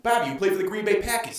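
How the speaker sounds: put-on high, fast Boston accent. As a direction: rattle it off in a mock Boston accent. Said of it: "How about Boston?".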